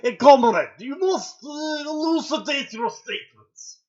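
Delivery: put-on loud, high barking Russian accent; angry Russian accent. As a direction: shout it like an angry Russian soldier. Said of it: "with angry Russian".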